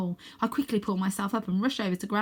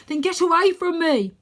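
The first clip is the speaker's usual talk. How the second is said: high, loud desperate order; urgent shout; 11 semitones higher, 9 dB louder than usual. Acted. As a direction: shove him away with the words. Grandad pushes Arthur away.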